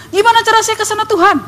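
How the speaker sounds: high-pitched pleading call, acted; shouting in the street. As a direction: beg God urgently for the way. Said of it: "Teriak-teriak di jalan gitu".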